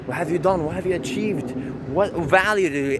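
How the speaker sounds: usual speaking voice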